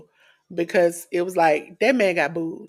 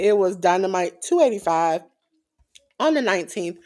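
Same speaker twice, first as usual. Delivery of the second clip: usual speaking voice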